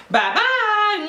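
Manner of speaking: high sing-song bye-bye. Real, not acted. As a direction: chirp the goodbye. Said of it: "sign-off".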